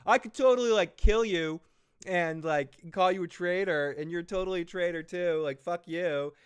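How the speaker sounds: high, loud hammed-up queen's rant; mock angry yell. Rhaenyra mock yelling at the Baratheon lord. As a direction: yell it like a queen losing her temper at a useless lord. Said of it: "She's like".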